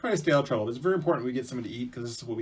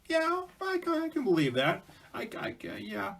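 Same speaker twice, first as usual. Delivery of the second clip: very high, halting little voice; stammering high-pitched character; little man; 11 semitones higher than usual. Acted. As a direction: squeak it out like a tiny odd man. Little man answers the door.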